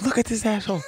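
high, soft imitation of mom; mother's reaction. Mother showing son the clip, high soft disdain.

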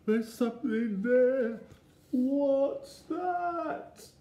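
very high, loud fright; rising high-pitched alarm; alarmed little boy. A narrator's reading. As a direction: squeal it as a startled child. Eddie spots something in the wood.

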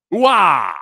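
high, loud stretched exclamation; Korean wow. Shown as a demonstration. Host demonstrates a Korean word for wow, loud drawn-out exclamation. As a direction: shout "Wah!" as one long falling wail. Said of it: "the Korean word for wow".

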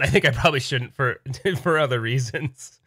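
ordinary talk, nothing marked

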